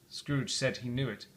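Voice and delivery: ordinary delivery, nothing marked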